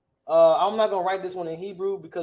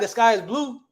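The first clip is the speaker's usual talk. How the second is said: higher-pitched, mock-proper full sentence; proper speech; 6 semitones higher, 3 dB louder than usual. Shown as a demonstration. Teacher showing how we don't talk, mock proper. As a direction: say it properly, with the IS put in. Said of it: "it's probably the proper way to talk".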